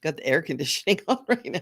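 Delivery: normal talking voice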